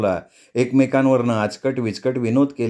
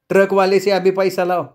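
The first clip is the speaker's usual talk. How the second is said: higher-pitched woman's voice, insisting; wife's demand; 7 semitones higher than usual. A narrator's reading. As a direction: press for the money through clenched teeth. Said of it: "दात चावून बोलायला लागली". (पैशासाठी हट्ट धरणारी बायको, उंच आवाजात)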